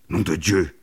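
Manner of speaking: mild astonished curse; taken-aback exclamation; startled oath. Acted. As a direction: swear at a sudden discovery. Doc swears at discovery.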